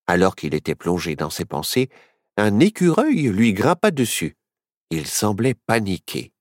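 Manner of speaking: ordinary delivery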